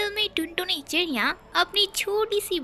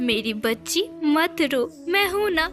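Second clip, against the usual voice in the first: put-on old grandmother; 2 semitones lower than usual. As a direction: comfort her like an old grandmother.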